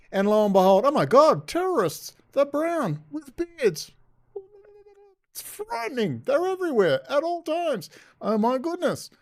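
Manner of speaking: high, loud mock horror; sarcastic shrill panic; sarcastic fright. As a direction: overplay the terror, high and loud. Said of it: "Yeah, I'm being sarcastic".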